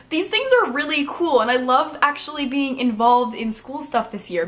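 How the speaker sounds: normal talking voice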